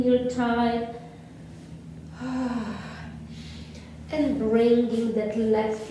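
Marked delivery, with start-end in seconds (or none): 1.7-4.0 soft, long audible exhale; long relaxing sigh. Real, not acted. Yoga teacher exhaling with the class, soft sigh. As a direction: let the breath out slowly and softly.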